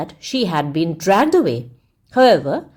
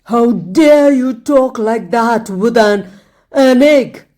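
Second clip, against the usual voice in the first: louder, slow, scornful fury; contempt and rage; 3 semitones higher, 6 dB louder, slower than usual. A narrator's reading. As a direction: sneer out the word egg in rage. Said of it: "He spoke with contempt as well as rage".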